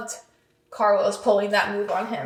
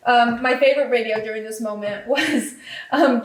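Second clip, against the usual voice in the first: ordinary talk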